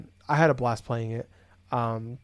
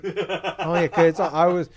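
laughing; 4 semitones higher, 5 dB louder, slower than usual. Real, not acted. Laughing at forgetting the game.

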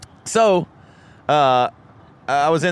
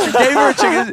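laughing; 6 dB louder than usual. Real, not acted. Laughing retelling punchline.

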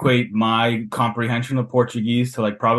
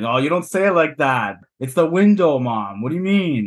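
poking fun; 3 semitones higher than usual. Retold or imitated. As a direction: imitate a kid teasingly correcting a parent. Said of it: "I would poke fun at mom".

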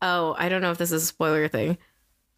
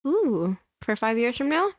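usual speaking voice

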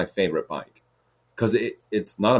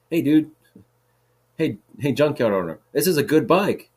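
higher, louder retold hailing; re-enacted shout for attention, moderately raised; calling out; 7 semitones higher, 4 dB louder than usual. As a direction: call after someone who is ignoring you. Calling to owner who ignores him.

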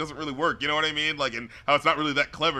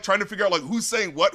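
ordinary delivery

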